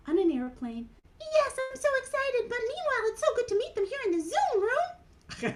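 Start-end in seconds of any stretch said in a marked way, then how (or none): 1.2-5.5 much higher, eager little puppet voice; a shy little puppet, thrilled; Mimi puppet voice. Acted. Puppet Mimi telling Ellen she loves new friends, high and eager.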